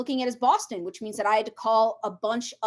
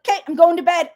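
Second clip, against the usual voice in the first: loud, higher mock quick sign-off; abrupt sign-off; 5 semitones higher, 8 dB louder than usual. Friend ending a call without saying bye, abrupt sign-off. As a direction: toss off an abrupt phone goodbye. Retells curt hang-up on phone.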